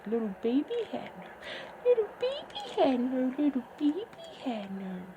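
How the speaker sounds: sing-song up and down, high peak; teasing singsong chant; playful singsong. Acted. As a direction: toy with the words like a rhyme. Playful repeated phrase.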